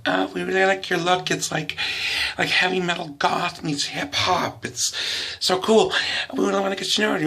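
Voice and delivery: high, breathless fangirl gushing; gushing girl. Retold or imitated. Girl fawning over the comedian's look, high gushing voice. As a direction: pitch it way up and gush like an eager fan girl.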